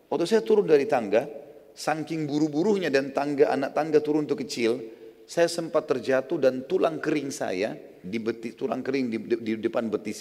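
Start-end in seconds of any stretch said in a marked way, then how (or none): none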